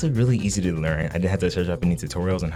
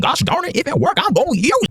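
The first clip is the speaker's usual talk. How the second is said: squeaky high defiance; jokingly stubborn, way up high; mock defiant; 4 dB louder than usual.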